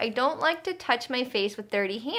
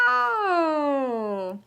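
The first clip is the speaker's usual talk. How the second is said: puppet voice; 4 semitones higher than usual. Acted. Puppet answers for Mama Roo.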